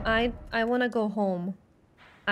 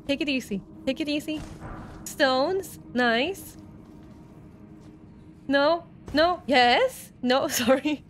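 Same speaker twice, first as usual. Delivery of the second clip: ordinary talk, nothing marked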